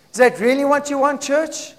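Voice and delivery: normal talking voice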